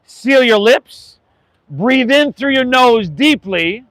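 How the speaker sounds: slow, calm teacher voice; yoga instructor talking the class through a breath; slow guided breathing. Acted. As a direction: ease them into the breath, unhurried.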